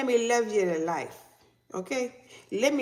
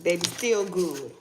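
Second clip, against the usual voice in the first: ordinary delivery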